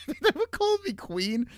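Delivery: squeaky laughing retelling; laughing disbelief. Real, not acted. Streamer to chat, laughing while retelling girls calling him queen.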